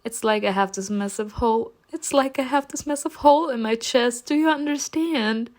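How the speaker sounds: high, hammed-up tearful lament; fake sobbing. Narrator sobs so Erica hears, high dramatic whining. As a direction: lament it theatrically through put-on sobs. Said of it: "I sobbed again as loudly as I could".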